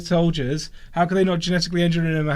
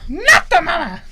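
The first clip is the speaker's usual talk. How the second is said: put-on high squeaky baby catchphrase; sing-song baby taunt; 4 dB louder than usual. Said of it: "be the baby".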